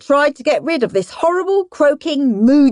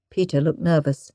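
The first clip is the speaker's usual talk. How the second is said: ordinary delivery, nothing marked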